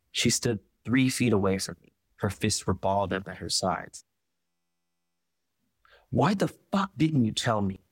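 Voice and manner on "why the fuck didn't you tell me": a raised, demanding question with a touch of heat; sharp accusing demand; angry demand. Acted. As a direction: Jan confronts her, hurt and furious. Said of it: "she demanded".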